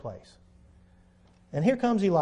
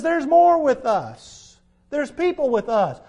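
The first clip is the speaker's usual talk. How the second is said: preaching; 8 semitones higher, 5 dB louder, slower than usual. Acted. Preaching the key verse.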